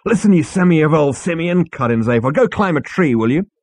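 loud, high sneering; scornful insult. A narrator's reading. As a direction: put him down hard. Zaphod insulting Arthur.

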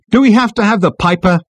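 loud, high-pitched complaint; exasperated demand. A narrator's reading. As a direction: demand it over the noise. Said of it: "demanded Ford".

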